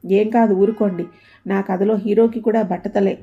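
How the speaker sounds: plain reading voice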